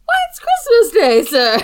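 put-on high, loud hammy street boy; silly clown boy. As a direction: answer up like a cheery urchin. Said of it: "we're like".

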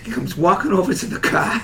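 ordinary delivery

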